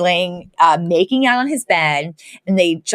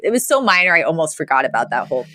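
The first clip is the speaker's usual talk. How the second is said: ordinary talk, nothing marked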